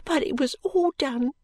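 put-on high, slow child's voice; earnest small maid promising gratefully; grateful little girl. Little maid keeps answering mistress.

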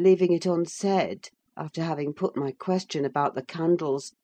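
ordinary delivery, nothing marked

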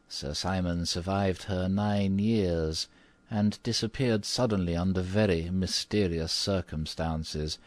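put-on old housekeeper telling a dark legend; low, mysterious. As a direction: unfold the grim tale mysteriously. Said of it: "in the same low, mysterious voice".

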